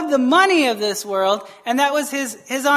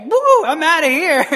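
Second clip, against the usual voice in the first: high, louder comic whoop; playful panicked exit; 6 semitones higher, 5 dB louder than usual. Acted. Preacher voicing the fleeing donkey, playful panic. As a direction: play a donkey bolting in alarm.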